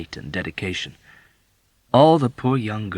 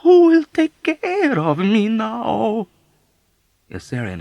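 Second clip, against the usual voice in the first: very slow, high, loud lament; wailing old woman; 8 dB louder, slower than usual. Acted. Old woman wailing to Yossarian about her abandonment. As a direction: stretch every word into a wail.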